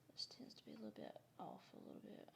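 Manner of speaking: quick soft whisper. Real, not acted. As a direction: murmur it under your breath. Whispering while drawing.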